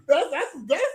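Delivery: usual speaking voice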